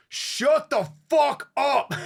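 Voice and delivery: very high, loud, drawn-out mock scream; full-volume retold shout; imitated yell. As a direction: bellow it slowly, imitating a fed-up rocker. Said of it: "like, yelled".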